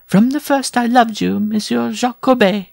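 very high, loud bride voice; high lovestruck bride; amorous bride. A narrator's reading. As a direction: declare love in a high-pitched put-on voice. Bride declares love to her groom.

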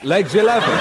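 rising bingo call; lilting bingo call, ending up; bingo caller. Acted. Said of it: "bingo call, then repeated laughing".

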